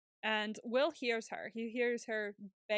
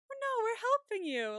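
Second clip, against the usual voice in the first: very high, louder sing-song imitation; hammed-up sweet protest, pitched way up; mock reassuring nurse; 13 semitones higher, 5 dB louder than usual. Nurse answering Nomi's murder accusation, mock soothing.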